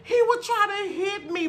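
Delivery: usual speaking voice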